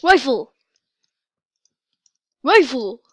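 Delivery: loud, sharp call. Real, not acted. Player calling out for a rifle, loud.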